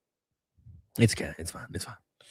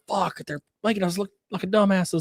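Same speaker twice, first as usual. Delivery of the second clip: mock panicked protesters; 7 semitones higher than usual. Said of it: "They're like".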